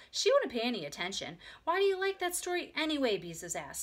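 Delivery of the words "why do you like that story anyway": high, louder question; exasperated. A narrator's reading. Big sister questions child's taste, exasperated. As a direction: ask in exasperation.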